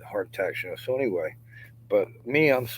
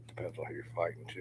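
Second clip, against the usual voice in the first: fast joking whisper; 3 semitones lower, 9 dB quieter, faster than usual. Real, not acted. Muttered joking aside.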